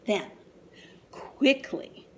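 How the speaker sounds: plain reading voice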